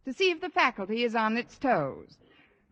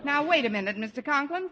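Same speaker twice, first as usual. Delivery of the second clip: ordinary delivery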